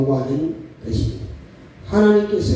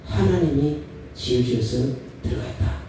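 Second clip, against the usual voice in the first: humble soft demo; 4 semitones lower, 5 dB quieter than usual. Shown as a demonstration. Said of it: "예수 믿는 사람은".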